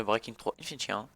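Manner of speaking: usual speaking voice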